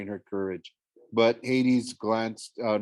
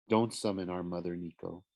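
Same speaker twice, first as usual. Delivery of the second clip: low, much softer ghostly warning; 5 semitones lower, 7 dB quieter than usual. Acted. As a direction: warn him in a hushed voice. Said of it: "she warned".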